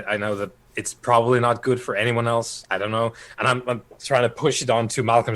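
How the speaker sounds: normal talking voice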